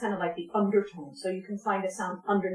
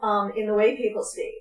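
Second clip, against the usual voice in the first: ordinary delivery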